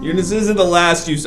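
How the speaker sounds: villain voice. Pretend. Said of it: "I sound like a villain now".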